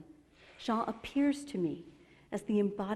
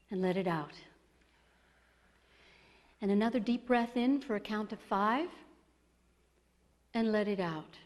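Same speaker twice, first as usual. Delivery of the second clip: guided meditation; 2 semitones higher than usual. Acted. Leads group meditation.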